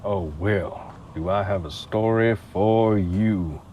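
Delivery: slow, easy Southern storyteller; Southern drawl. Acted. Smooth talker starting his story for the boss, Southern drawl. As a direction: ease into a tall tale with a drawl.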